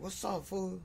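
high mocking imitation of a big guy's thin voice. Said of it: "he talked like that".

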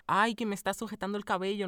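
mock a Madrid girl putting on a Latin American accent; imitating an exaggerated Latin American accent.

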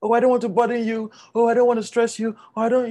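high, quick apologetic excuses; acted not wanting to be a bother; self-effacing refusal. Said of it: "When they say".

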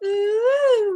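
high squealing ooh; excited squeal. Retold or imitated. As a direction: squeal with delight. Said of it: "You were just squealing and so excited".

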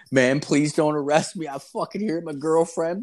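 high-pitched imitation of begging a cop; hammed-up desperate begging; mock pleading. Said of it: "I turned into bitch mode".

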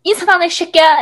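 loud, high mock scolding; retold scolding. Sister telling off her brother for not saying hi, mock-angry.

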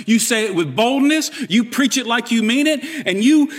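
ordinary talk

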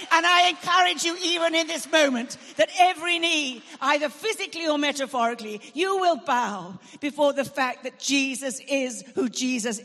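loud, rising sermon cry, pressing each phrase; open-air preacher calling the crowd to worship; preaching at full force. Acted. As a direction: preach it out over a field crowd. Preaching builds in the field.

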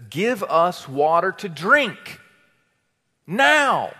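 loud, slow, high-pitched command; forceful demand. Acted. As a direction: demand it, landing hard on the last word. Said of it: "They demand".

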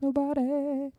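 melodic. Shown as a demonstration. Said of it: "That was very melodic".

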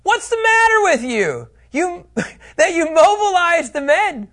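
hammed-up offended shout after the thieves; indignant Micah. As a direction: Micah yelling after the Danites.

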